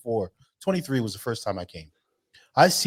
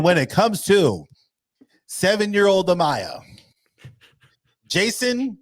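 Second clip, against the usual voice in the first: mock host, slow and louder; Maury verdict; 6 semitones higher, 5 dB louder, slower than usual. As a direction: drag out the verdict like a show host. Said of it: "retelling Maury verdict".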